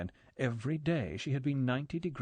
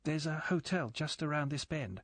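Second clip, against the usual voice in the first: nervous; 4 semitones higher than usual. A narrator's reading. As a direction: say it timidly, hoping he agrees. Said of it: "said Galen nervously".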